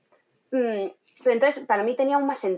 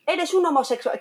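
mocking child's insult; 7 semitones higher than usual. Children insulting each other at school.

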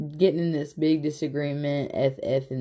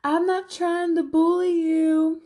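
high, tearful imitation of a sobbing woman; mocking Avery crying at Jesse, high and tearful; 13 semitones higher, 3 dB louder than usual. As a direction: play her crying and pleading, hammed-up. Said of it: "She's like".

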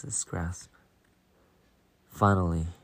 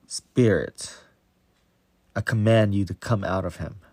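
loud, firm order to the spirit; stern command; 7 dB louder than usual. A narrator's reading. Jesus orders the evil spirit out of the boy, loud and stern. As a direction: command it out with full authority. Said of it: "he ordered sternly".

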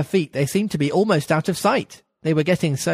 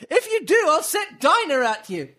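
much higher, calling out as loud as she can; raised, mock-fierce call; 10 semitones higher than usual. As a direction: shout a threat to the rabbit. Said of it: "called out as loud as she could".

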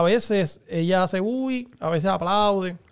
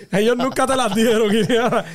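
laughing tease; 2 semitones higher, 4 dB louder than usual. Real, not acted. Teasing friend while laughing.

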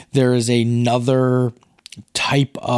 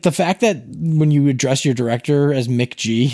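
normal talking voice

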